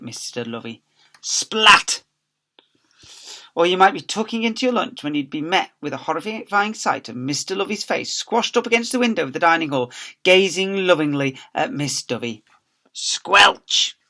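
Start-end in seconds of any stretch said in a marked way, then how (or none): 1.2-2.0 high, loud splat; cartoon splat; comic splat. A narrator's reading. Sound effect of air kiss. 12.9-14.0 unvoiced squelch; comic squelch. A narrator's reading. Sound effect.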